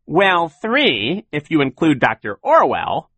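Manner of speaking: high, loud, teasing correction; sly villain. A narrator's reading. Count Olaf corrects the tally of criminals, high and mocking. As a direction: correct him with villainous relish.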